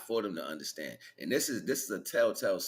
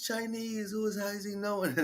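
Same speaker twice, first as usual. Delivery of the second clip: mock wife voice; 14 semitones higher than usual. Wife voiced in retold movie.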